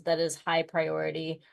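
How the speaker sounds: ordinary delivery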